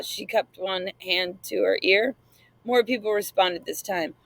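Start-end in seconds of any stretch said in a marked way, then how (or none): none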